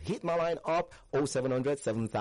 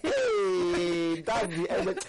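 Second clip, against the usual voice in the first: excited cheer; 10 semitones higher, 4 dB louder, much slower than usual. Real, not acted. Celebrating end of twister.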